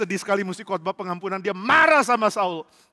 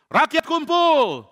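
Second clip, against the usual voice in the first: slow summoning call; 9 semitones higher, 5 dB louder, much slower than usual. Acted. David summons his men.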